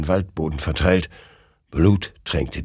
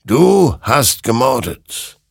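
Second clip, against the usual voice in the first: slow, loud, raised condemnation; grave accusation; 6 semitones higher, 5 dB louder, slower than usual. A narrator's reading. The creature condemns the soldier as a murderer, slow and accusing. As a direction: stretch the accusation out with force.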